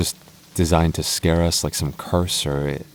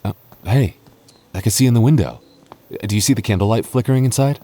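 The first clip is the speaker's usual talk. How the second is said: excited; 5 semitones higher, 3 dB louder, faster than usual. Acted. Spotting candlelight in the window.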